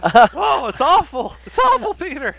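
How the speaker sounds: high, loud hammed-up lament; mock dismay. To Peter about fake news, hammed-up high wail.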